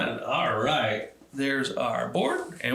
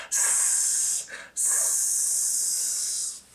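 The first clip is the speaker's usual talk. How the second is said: long hissing s; kettle hiss; 5 dB quieter than usual. Acted. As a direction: let the steam hiss out. Kettle sound retold.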